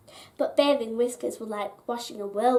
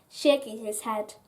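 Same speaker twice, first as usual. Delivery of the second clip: plain reading voice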